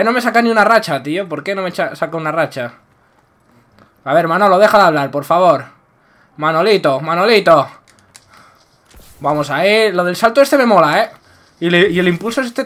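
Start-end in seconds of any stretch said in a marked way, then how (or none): none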